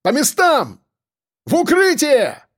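urgent high-pitched cry, last word drawn out; high, alarmed yell; shouted warning. Acted. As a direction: shout orders to take cover. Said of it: "крикнул кто-то из сербов".

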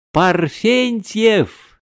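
high, loud, hugely stretched name; joyful yell. A narrator's reading. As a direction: bellow the surname with delight. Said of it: "заорал он необыкновенно радостно". (радостно заорать, протяжно)

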